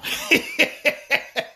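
breathy self-conscious chuckle; embarrassed laugh. Real, not acted. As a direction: laugh it off sheepishly. Laughing at his own statement.

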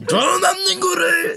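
very high, hammed-up wail; mock panicked cry. Comedian waits for the risky punchline, high mock-panicked cry. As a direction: wail it in comic dread. Reliving panic on live show.

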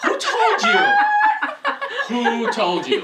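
very loud, high squeal through laughter; laughing shriek. Real, not acted. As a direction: shout it high, cracking up.

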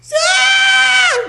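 very high, loud mock shriek; panicked scream. Lady visitor meeting a bear, hammed-up panicked scream. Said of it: "just freaking out".

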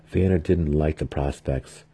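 ordinary delivery, nothing marked

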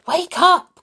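high, loud wake-up call; excited cry. Acted. Mister Silly waking sleeping friend, loud cry. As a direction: shout him awake. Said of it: "cried Mister Silly".

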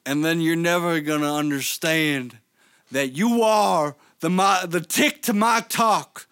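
shouted high Southern wrestler promo; wrestling promo. Acted. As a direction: roar it like a Southern pro wrestler. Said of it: "I love how [name] turned Southern".

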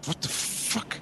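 hushed, airy disbelief on waking; startled whisper. Retold or imitated. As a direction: whisper it, half awake and baffled. Retold waking up to attack.

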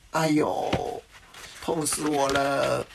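drawn-out mock groan of pain; storybook pained wail. A narrator's reading. Old man who fell down the hole, drawn-out pained wail. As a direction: wail slowly, as if hurt by a fall.

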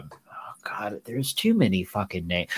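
real frustrated whisper under the breath; whispered aside. Real, not acted. Losing track of the characters' names, whispering to herself. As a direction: hiss it to yourself, off the record.

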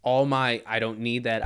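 ordinary talk, nothing marked

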